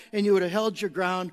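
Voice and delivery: usual speaking voice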